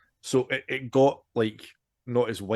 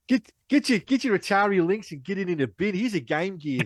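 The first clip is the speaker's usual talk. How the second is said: mock Australian; 8 semitones higher, 3 dB louder than usual. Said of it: "it's an Australian".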